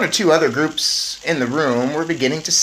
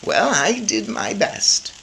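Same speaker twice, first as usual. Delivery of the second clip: lightly voiced grandmother; 7 semitones higher, slower than usual. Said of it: "my grandmother said".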